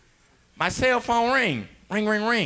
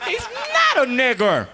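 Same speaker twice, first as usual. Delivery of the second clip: normal talking voice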